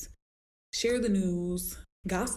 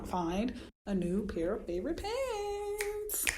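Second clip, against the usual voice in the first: teasing; 6 semitones higher, slower than usual. Retold or imitated. Teasing advice to client.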